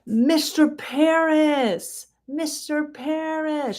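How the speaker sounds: high, slow, drawn-out cry of recognition; high, stretched-out cry of joyful recognition; cried out. Acted. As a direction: call the name out high and slow, overwhelmed. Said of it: "she cried".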